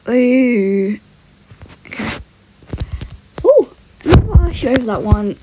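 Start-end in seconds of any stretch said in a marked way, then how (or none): none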